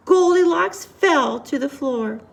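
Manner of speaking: ordinary delivery, nothing marked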